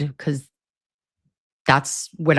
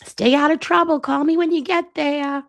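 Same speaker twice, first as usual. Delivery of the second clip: much higher doting grandma voice; caring grandma; 11 semitones higher than usual. Retold or imitated. Nana sending Kim off, fussing in a much higher voice. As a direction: fuss over her like Nana. Voicing Nana's caring goodbye.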